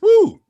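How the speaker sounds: high, loud "woo"; cheering whoop. Real, not acted. Comic rallying crowd for Uber Eats, cheering whoop.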